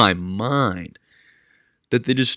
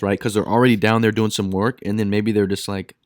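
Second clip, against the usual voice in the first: ordinary talk, nothing marked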